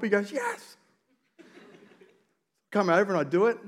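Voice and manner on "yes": very high mock-child reply; squeaked, exasperated kid voice; frustrated child. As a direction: imitate an upset kid accepting help. Imitating frustrated son answering father.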